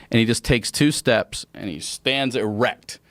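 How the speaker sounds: ordinary delivery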